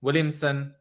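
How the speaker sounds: ordinary talk, nothing marked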